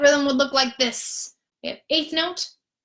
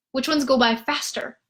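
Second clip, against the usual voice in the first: ordinary delivery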